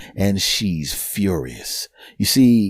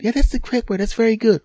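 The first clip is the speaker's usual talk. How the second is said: high-pitched, approving woman's voice; encouraging lady; 12 semitones higher, faster than usual. Retold or imitated. Lady encourages the struggling foreigner, high and approving. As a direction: cheer him on in a high voice.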